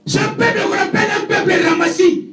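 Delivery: sermon delivered with fervour; prédication. Acted. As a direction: preach it, rising and full-voiced. (prêche ardent à l'assemblée)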